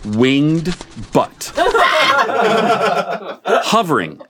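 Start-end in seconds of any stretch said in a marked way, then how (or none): 1.6-3.7 high bursting laugh; burst of laughter. Real, not acted. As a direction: crack up laughing. Laughs at own butt story.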